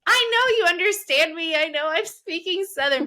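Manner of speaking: loud, high retold insistence; exasperated protest. Retells protest at being misunderstood.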